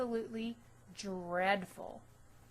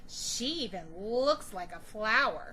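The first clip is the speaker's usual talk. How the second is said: mocking taunt; 7 dB louder than usual. Bully taunting.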